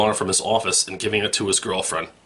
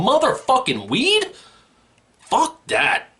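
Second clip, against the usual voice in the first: hammed-up high-pitched outrage; outraged squeal; much slower than usual. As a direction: screech your disbelief at the news. Rant continues.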